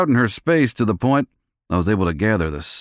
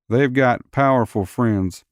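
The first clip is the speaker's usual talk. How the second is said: warning bartender; 6 semitones higher than usual. A narrator's reading.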